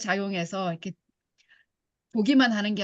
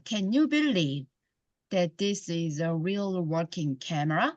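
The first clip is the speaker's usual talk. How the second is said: very slow, words run together; slow linked reading; 3 semitones lower, much slower than usual. Shown as a demonstration. Teacher showing students linking sounds, in a very slow linked read. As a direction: stretch it out and glide each word into the next. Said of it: "이렇게 연음으로 붙여서".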